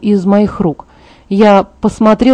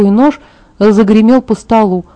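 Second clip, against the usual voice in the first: ordinary delivery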